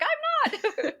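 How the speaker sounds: high, laughing reply. Real, not acted. Telling people she won't be on TV, laughing. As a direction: burst out laughing on the words.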